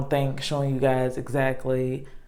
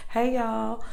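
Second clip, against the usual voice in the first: high-pitched country "Hey, y'all"; country accent; 8 semitones higher, 4 dB quieter than usual. Shown as a demonstration. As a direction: drawl the greeting Southern style. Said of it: "in my, like, country accent".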